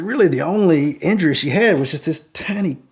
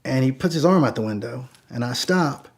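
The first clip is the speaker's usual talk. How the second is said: ordinary delivery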